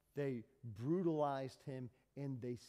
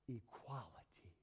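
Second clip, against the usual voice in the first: whisper; 4 semitones lower, 12 dB quieter than usual. Real, not acted. Preacher drops to an aside.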